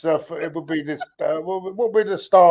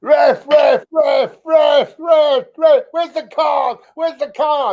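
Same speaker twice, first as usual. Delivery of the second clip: much higher, shouted ref ref; mock ref complaint; 10 semitones higher, much slower than usual. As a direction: ham up a fan screaming at the ref. Mocking mate's complaints at referee.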